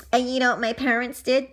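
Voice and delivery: ordinary delivery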